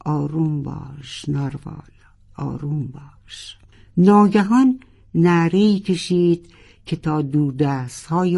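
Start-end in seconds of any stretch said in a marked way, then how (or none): 0.0-3.6 soft, fading into a whisper; hushed self-calming, ends whispered; under his breath. A narrator's reading. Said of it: "زیر لب تکرار کرد".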